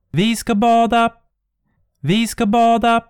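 a phantom's gleeful shriek; high loud ghostly call; shrieking ghosts. Acted. Ghosts cry out again.

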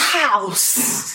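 one word in a put-on German-sounding accent; mock German-Russian accent. Teasing the trailer accent, mock German-Russian accent. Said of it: "Not German".

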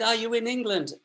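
lightly acted high mum voice; imitated mum. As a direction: put on a mum's voice down the phone. Acted mum questioning son on phone.

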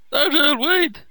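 mock shout. Reviewer mimics the girl's pointless shout, mock yelling. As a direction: mockingly bellow the girl's line. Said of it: "Why is she shouting?".

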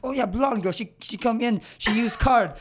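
put-on high-pitched, made-up foreign accent; badly improvised accent of no clear country; uncertain foreign accent. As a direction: imitate a shop worker in a muddled foreign accent. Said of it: "I don't know what accent I'm trying to go for".

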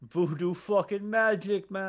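slow, high mock Jamaican voice; Jamaican accent. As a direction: drawl it in a bad Jamaican accent. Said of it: "I can't do Jamaican voice".

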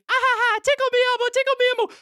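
high-pitched giggly toy voice; talking Elmo toy. Retold or imitated. Storyteller imitating the toy as it starts up, high toy voice. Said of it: "it starts going".

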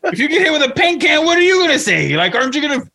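high, laughing mock rant; imitating Joe Pesci. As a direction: pitch it up and laugh through the argument. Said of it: "And he's like".

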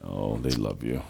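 low, slow mock comfort; sarcastic. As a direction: fake soothing, sarcastically.

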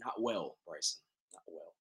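very soft, glum reply; downcast, trailing away almost to nothing; dejected. Acted. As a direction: mope quietly over a lost bet. Lost bet, glumly answers host.

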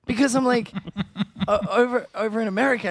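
high, laugh breaking through the words. Real, not acted. Host laughing at his own accent story, talking through giggles. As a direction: giggle through the start of the sentence.

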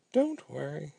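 usual speaking voice

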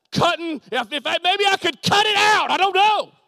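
high, loud cry to the congregation; preaching outburst. Real, not acted. Preacher reliving old confusion about cutting, high loud outburst. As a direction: burst out high and forceful. Bursts out in exasperation.

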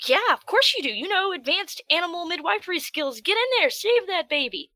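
high, loud hammed-up encouragement; mock excited DM. DM urging druid to deliver goat, hammed-up excitement. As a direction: egg them on, over the top. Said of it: "You're like".

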